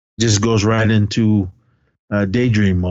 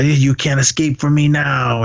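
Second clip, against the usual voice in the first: put-on failed movie German accent; loose, badly faked German accent; 4 semitones higher than usual. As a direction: attempt a German accent and miss. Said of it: "whatever the German accent is".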